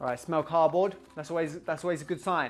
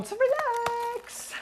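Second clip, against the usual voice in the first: high voice with a long drawn-out ending; playful high wail; silly announcement; much slower than usual. Pretend. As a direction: announce it way up high, stretching the last word. Mock fanfare announcing next tea.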